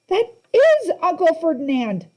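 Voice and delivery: high, loud gasping alarm; gasped. A narrator's reading. Grover realizes the statue truth, gasping in horror. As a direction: blurt it in horror.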